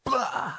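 loud high "Blah!" burst; harsh outburst sound. Shown as a demonstration. Pastor showing a too-strong tone: loud burst. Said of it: "that came out too strong".

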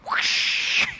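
long rushing hiss made with the mouth; airy, voiceless whoosh; imitated water whoosh. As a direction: homemade stage effect of thrown water. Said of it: "some kids backstage going".

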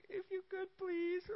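mock a timid whiner begging the king; whiny plea. Preacher mocking a timid petitioner, high soft whine. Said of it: "he's also not a whiner".

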